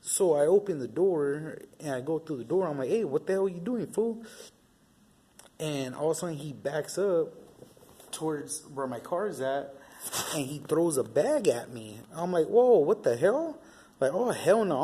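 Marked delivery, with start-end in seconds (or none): 2.9-4.2 higher-pitched, indignant challenge; retold confrontation. 12.5-14.8 loud, high startled indignation; retold pissed-off outburst. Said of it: "I'm pissed at that point".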